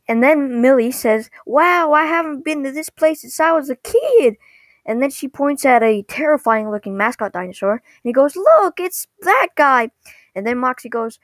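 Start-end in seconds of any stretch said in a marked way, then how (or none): 1.5-4.4 high-pitched, thrilled imitation; excited wonder. Millie thrilled to see the park. 8.3-9.9 high, loud, drawn-out excited imitation; excited pointing-out. Millie points out the mascot excitedly.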